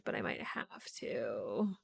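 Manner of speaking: whispered, slow, grudging admission; reluctant whisper. Real, not acted. Streamer admitting to chat she may need quick play, slow whisper. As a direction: breathe it out slowly.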